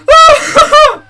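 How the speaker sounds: high, loud nervous shriek of laughter; real hysterical laugh. Real, not acted. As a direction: burst out in a panicked laugh. Nervous laugh after freaking out.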